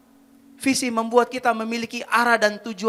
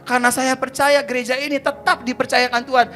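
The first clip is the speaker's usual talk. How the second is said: loud, raised, driving sermon delivery; fervent preaching; 6 semitones higher, 10 dB louder than usual. Real, not acted. Preacher declares his zeal to the congregation, fervent preaching. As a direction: build up and proclaim it with full passion.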